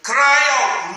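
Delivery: loud, high cry of Cry out; shouted call. Shown as a demonstration. Pastor shouting Cry out to the congregation. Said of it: "shouted call to cry out".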